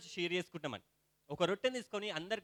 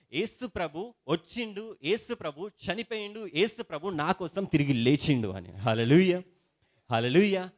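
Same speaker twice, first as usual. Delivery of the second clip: fervent preaching; 8 dB louder, slower than usual. Acted. Pastor rousing the congregation about communion, fervent preaching. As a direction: rouse the congregation with rising fervor.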